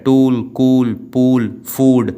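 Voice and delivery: "टूल, कूल, पूल, फूड", each with a held oo; long oo. Shown as a demonstration. Teacher listing long-vowel examples, drawn-out "टूल, कूल, पूल, फूड". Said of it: "ऊ sound दे रहा है".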